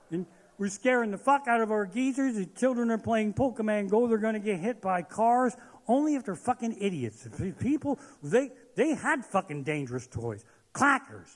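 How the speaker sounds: ordinary talk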